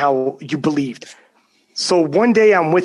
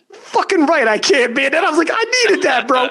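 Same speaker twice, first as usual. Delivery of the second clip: pumped up; 10 semitones higher than usual. Retold or imitated. Pumped after nephew's belief.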